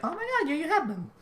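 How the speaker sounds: very high squealing delight; excited squeal. Retold or imitated. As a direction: squeal like a thrilled fan. Excited fan reaction to wings.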